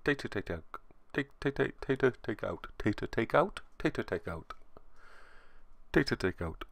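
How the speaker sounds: very soft tongue-twister muttering to self; muttered practice. Real, not acted. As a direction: quietly drill the hard phrase under your breath. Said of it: "It's still not easy to say".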